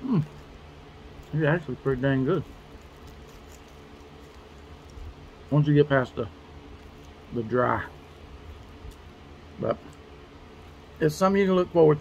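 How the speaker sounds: talking with mouthful; eating while speaking; chewing food. Real, not acted. Seen 4: Bites ration bar at -2, jaw chewing through labelled words.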